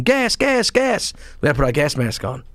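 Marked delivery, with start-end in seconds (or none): 0.0-1.1 mock alarm call. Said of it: "they start screaming".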